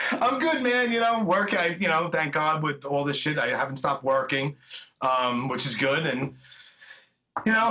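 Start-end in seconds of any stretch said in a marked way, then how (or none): 0.1-1.5 breathless, catching his breath. Real, not acted. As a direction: talk while still out of breath. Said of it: "I'm out of breath".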